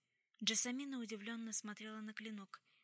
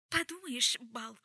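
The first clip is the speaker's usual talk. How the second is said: angry exclamation; 2 semitones higher, 9 dB louder, slower than usual. A narrator's reading. Jessamine scoffing at her accuser, angry and hysterical. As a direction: burst out crossly, hysteria creeping in. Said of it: "сердито воскликнула Джессамина, но в голосе прорезались истерические нотки".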